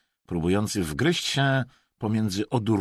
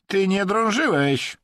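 high, loud, drawn-out retort; insolent interruption; 13 semitones higher, 6 dB louder than usual. A narrator's reading. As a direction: butt in cheekily. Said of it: "bezczelnie przerwał mu". (bezczelnie, głośno i wysoko)

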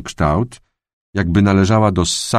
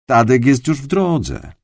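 colleague voice; 7 semitones higher than usual. A narrator's reading. Colleague answers the prosecutor.

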